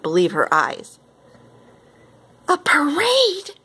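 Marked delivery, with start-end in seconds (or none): 2.5-3.6 high, drawn-out disbelief; astonished storybook exclamation; shocked gasp. A narrator's reading. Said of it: "she gasped".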